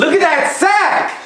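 high, slow thrilled call; excited shout. Real, not acted. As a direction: yell out the big play. Reacting to a game play.

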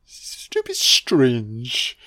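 put-on a puzzled boy sizing up the snowman; Jimmy voice. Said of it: "Jimmy's like".

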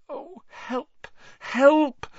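high, panicked whispered plea; frantic whisper. A narrator's reading. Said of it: "Hermione whispered frantically".

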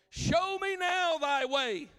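high, loud and slow pleading; shouted prayer. Acted. Preacher voicing Moses begging God, loud and slow. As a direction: stretch each word out like a desperate plea.

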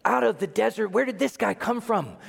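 high, astonished questioning; amazed onlooker. Acted. Onlooker seeing a hero come from the desert, amazed. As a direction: ask it in high-pitched amazement.

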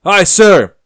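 high, loud shout in unison; soldiers shouting. Acted. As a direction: bark it back like a squad. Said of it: "The highly skilled men shout in unison".

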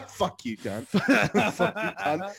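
high, loud swearing through laughter; real laughing comeback; laughing insult. Real, not acted. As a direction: swear at a mate while cracking up. Laughing back at friend's teasing.

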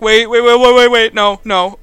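loud, repeated pleading; mock panic. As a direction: blurt it out to halt everything. Peter panics as cage comes down.

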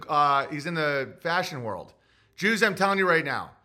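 ordinary talk

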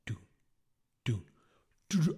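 two spaced steps, then a quick burst; short "du" thuds breaking into a run; imitated footsteps. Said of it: "I just hear the footsteps running by".

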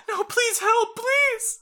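very high, loud begging; desperate plea. Acted. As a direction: plead frantically for help. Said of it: "She's like".

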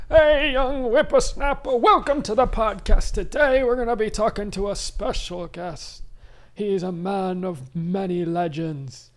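put-on high old-timer host voice; a grizzled mountain man introducing the show; old prospector. Hosts podcast in put-on voice.

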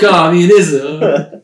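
put-on slow, drawn-out joke Russian accent on Communism; hamming up a Soviet Russian accent; a laughing stab at a Russian accent; mock Russian accent. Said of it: "Just toss on a quick little accent".

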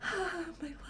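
soft, breathy mock swoon; dreamy sigh. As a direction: sigh it out like a lovesick maiden. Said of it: "just like".

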